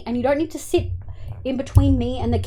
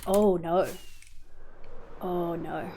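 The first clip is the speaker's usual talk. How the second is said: ordinary talk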